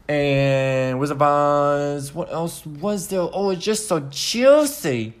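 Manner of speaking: put-on slow drawled mystery accent; unplaced foreign accent, slowly.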